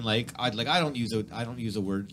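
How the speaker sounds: normal talking voice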